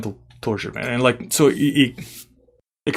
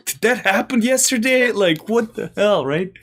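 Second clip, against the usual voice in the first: retold disbelief, pitched well up; incredulous, as if in a twilight zone; 10 semitones higher than usual. Player recalling his shock at the coach's turnaround, mock incredulous. I was like.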